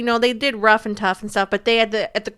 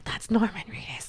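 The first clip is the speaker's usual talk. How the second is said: theater whisper; 10 dB quieter than usual. Retold or imitated. I leaned over in the theater.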